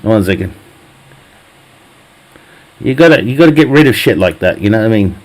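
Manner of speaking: ordinary talk, nothing marked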